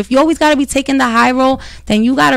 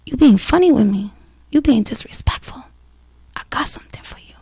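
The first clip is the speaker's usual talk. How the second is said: dropping to a threatening whisper; hushed mock menace; menacing whisper; 5 dB quieter than usual. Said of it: "it's more of like".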